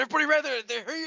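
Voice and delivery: high, loud warning shout; guard yelling a warning to everyone. Acted. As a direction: yell it out to the whole camp. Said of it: "all you hear is a shout saying".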